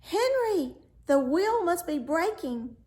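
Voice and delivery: high, loud alarmed call; prince crying out. Acted. Prince calling back to faithful Henry, high and alarmed. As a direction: shout back to the servant in alarm. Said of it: "he turned round and cried".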